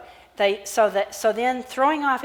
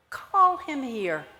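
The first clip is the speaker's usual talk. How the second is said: slow, gentle call; slow tender call; 4 semitones higher, much slower than usual. Acted. Jesus gently calling the blind man over, slow and tender. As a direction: draw it out lovingly. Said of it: "he said".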